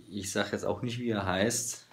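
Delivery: ordinary delivery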